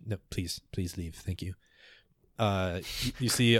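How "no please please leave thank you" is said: soft, polite dismissal; hushed, courteous brush-off from a ruler; quiet dismissal. Acted. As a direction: send them away quietly. Ruler gently dismisses his elders.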